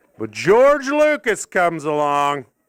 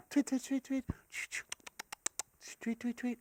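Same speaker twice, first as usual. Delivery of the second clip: tiny high chirps, very soft; imitated bird tweets; 6 semitones higher, 15 dB quieter than usual. Comic imitating birds on a video, soft tweeting. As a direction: do quick little birdsong, high and quiet.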